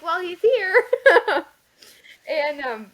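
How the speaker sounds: high, loud laugh breaking into tears; overjoyed, half laughing half crying; laughing through tears. Retold or imitated. Telling her guest about the newborn, laughing through tears.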